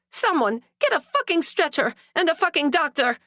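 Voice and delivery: high, fast yelled command; frantic call for medical help; urgent shout. A narrator's reading. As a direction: bark for a stretcher and doctor. Calling for help in emergency.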